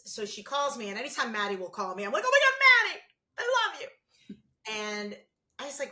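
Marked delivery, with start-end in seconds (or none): 2.2-3.9 high, loud squeal of delight; delighted phone greeting. Retold or imitated. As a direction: squeal it to a favourite kid.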